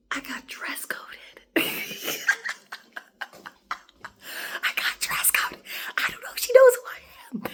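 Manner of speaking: hushed, half-crying whisper; mock-tragic whisper. Real, not acted. Telling viewers she got dress coded, mock sobbing. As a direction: whisper it like a tragedy. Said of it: "mock distress over dress code".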